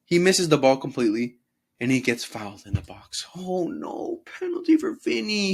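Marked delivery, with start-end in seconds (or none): 3.4-5.5 high mocking voice; sarcastic squeal for the penalty; mock whiny Vinny.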